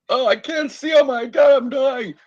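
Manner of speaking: high, loud mock panic at going blind. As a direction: yelp it like you think you're dying. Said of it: "I was like".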